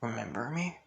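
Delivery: mildly surprised, voice lifting at the end; soft, halting disbelief that rises into a question; surprised king. Acted. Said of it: "With him surprised, saying".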